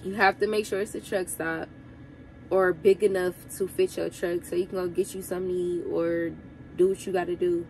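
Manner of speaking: ordinary talk, nothing marked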